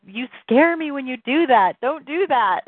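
imitating alarmed husband. Husband telling his crying wife she scares him, imitated alarm. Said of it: "He's like".

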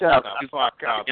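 slow mocking echo of a deep voice. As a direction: throw his deep voice back at him.

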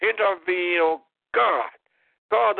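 usual speaking voice

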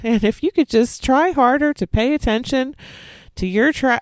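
mocking the neighbor. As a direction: lecture like a passive-aggressive neighbor. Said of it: "And she's like".

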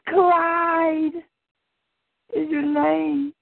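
calling his name up high; high note. Acted. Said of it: "I yelled out his name in a high note".

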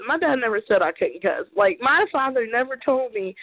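ordinary talk, nothing marked

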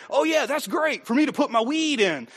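mock sketch-comedy delivery; Fallon skit voice. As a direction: lift into a silly comic voice for the punchline. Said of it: "He'd go".

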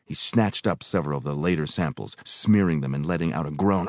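ordinary delivery, nothing marked

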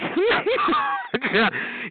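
high-pitched cackle; mocking laugh. Real, not acted. Laughing at the yelling caller, high cackle.

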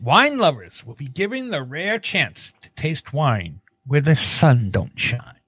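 ordinary delivery

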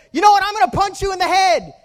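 high, loud acted threat shout; high-pitched angry holler; very upset boy. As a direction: yell it at full volume like a furious kid. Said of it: "he gets very upset".

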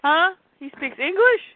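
put-on high kid-like puzzlement; puzzled child voice. Kid reacting to Superman, high puzzled voice. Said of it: "And he goes".

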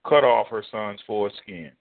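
ordinary delivery

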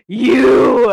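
loud, drawn-out dramatic accusation; accusing mock yell. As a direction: call it out like a horror victim. Re-enacting her bloody scare act.

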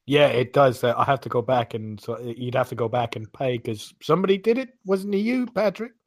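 ordinary delivery

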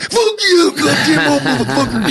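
very high, strained wrestler cursing; Macho Man. Retold or imitated. Macho chewed by the snake, high cursing impression. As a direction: screech it like the wrestler being bitten. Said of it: "You did a great Macho Man".

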